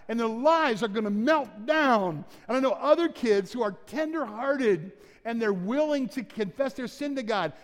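put-on rally the kids like a camp speaker; camp preaching. Pastor addressing the congregation as kids at camp, loud fervent preaching.

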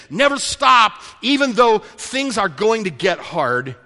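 loud, high, emphatic exhortation; impassioned preaching. Acted. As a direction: urge the congregation on forcefully.

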